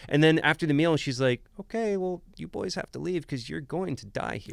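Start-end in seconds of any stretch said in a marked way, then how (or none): 1.6-4.5 put-on sweet but deadpan old lady, high and soft. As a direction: calmly warn them like a sweet grandma. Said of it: "in the sweetest but deadpan voice".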